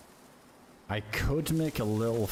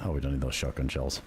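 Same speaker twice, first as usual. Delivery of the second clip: ordinary delivery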